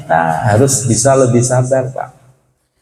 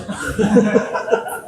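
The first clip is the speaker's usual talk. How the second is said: breathy laugh; 9 semitones higher, 5 dB quieter than usual. Real, not acted. Laughs at angry rider story.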